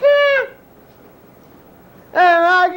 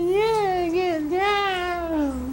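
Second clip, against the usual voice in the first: ordinary talk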